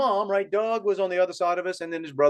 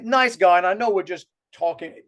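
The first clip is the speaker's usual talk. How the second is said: ordinary delivery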